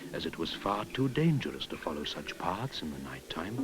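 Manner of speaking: ordinary delivery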